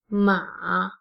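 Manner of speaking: MA low and dipping; third tone. Shown as a demonstration. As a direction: let "ma" sink low and stay down before lifting. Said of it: "is the third tone".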